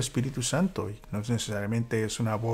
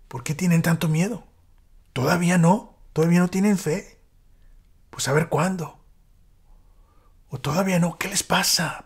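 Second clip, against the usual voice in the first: higher, louder, exasperated rebuke; irritated scolding; 7 semitones higher, 5 dB louder than usual. Retold or imitated. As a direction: scold them like an impatient teacher. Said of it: "podemos escuchar ese tipo de tono". (reproche irritado, más agudo y fuerte)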